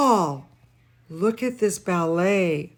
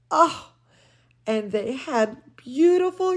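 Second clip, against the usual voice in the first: plain reading voice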